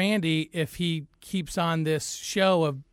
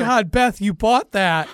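loud, high, laughing tease; laughing disbelief; 7 semitones higher, 7 dB louder, much slower than usual. Real, not acted. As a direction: tease her loudly through laughter. Host mocks Beth for being fooled.

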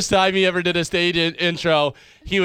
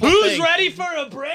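mock MC hype; 11 semitones higher than usual. Emcee announcing a break to the comedy crowd, mock hype call. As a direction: ham up the emcee's cheesy announcement.

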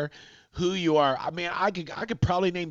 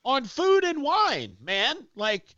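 exasperated retort; 10 semitones higher than usual. Retold or imitated. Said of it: "I go".

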